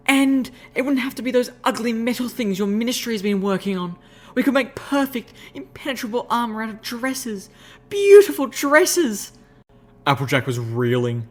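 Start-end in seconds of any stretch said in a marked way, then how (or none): 0.1-9.3 high excited lady's voice, loud at the end; rapturous gush. Acted. Rarity dreaming of dress armour, high excited gush. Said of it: "glowing in the rapture of her idea".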